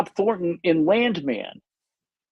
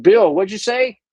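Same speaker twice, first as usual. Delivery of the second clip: mock shout; 4 semitones higher, 7 dB louder than usual. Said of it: "like, like".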